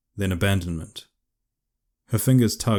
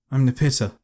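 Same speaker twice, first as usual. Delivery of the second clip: higher and louder, on guard; defensive; 6 semitones higher, 5 dB louder than usual. A narrator's reading. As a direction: snap back defensively. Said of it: "she replied defensively".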